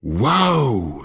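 put-on a boy amazed and overjoyed; delighted. A narrator's reading. Dennis exclaims with delight at the giant squid. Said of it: "zawołał uradowany Dennis".

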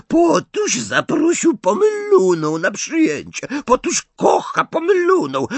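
very high, loud jeering imp; mock them in a shrieking sing-song; malicious poltergeist crowing overhead; screeching taunt. A narrator's reading. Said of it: "rechocząc i wrzeszcząc".

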